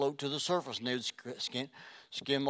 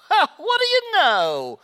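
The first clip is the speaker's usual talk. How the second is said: high, loud, slow mock surprise; drawn-out fake cheer; sarcastic; 13 dB louder, slower than usual. Mock-pious reaction to stolen spot.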